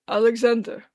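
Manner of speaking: hoarse, slurred stranger calling a name; raspy drunk voice. Acted. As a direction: rasp it out like a drunk. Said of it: "a raspy voice that Arthur's never heard".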